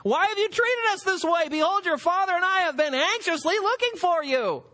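a worried mother scolding her son, pitched high; distraught mother. Acted. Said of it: "And his mother said to him".

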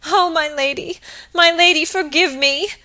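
high, loud, imploring cry; distressed pleading. A narrator's reading. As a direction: beg the mistress's forgiveness. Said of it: "sobbed Phoebe".

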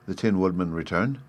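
ordinary delivery, nothing marked

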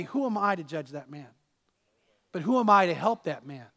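normal talking voice

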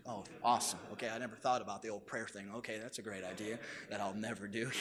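mock enthusiasm, dry and low; sarcastic. Sarcastic reply to pastor's suggestion.

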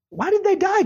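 put-on high childish question; little girl asking. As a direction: voice a small curious child. Dad voicing curious daughter.

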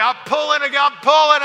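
high loud strained cry while pulling; straining effort. Retold or imitated. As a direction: shout it like you're lifting with everything. Straining to lift the bar.

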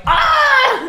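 high shrill yell of frustration; frustrated scream. Real, not acted. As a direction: let out a fed-up shriek. Frustrated outburst.

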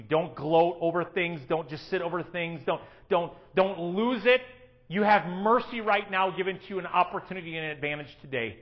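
normal talking voice

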